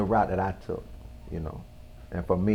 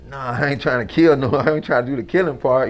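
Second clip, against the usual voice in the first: louder, higher pushback; raised-voice objection; retold protest; 4 semitones higher, 6 dB louder than usual. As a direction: push back firmly, retelling your refusal. Retold refusal to the tempting voice.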